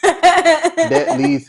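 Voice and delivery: words breaking up into loud laughter; laughing. Real, not acted. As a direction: crack up mid-sentence, high and loud. Cracks up at her retort.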